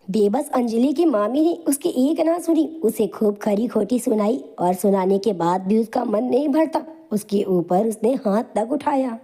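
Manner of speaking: usual speaking voice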